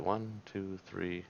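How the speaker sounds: soft, barely voiced count; counting to oneself. Real, not acted. Player checking a move by counting to himself, softly. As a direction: count quietly while working it out.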